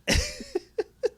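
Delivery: high-pitched real laugh. Real, not acted. Reviewer amused by television cliché, laughing. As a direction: let out a high wordless laugh.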